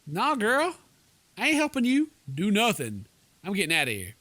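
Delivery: ordinary talk, nothing marked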